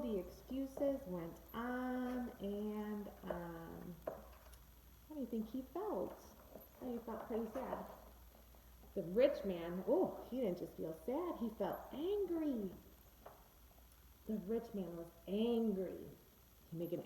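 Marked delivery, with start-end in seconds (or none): none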